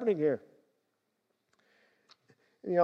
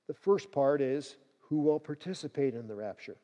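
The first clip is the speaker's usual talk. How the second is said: normal talking voice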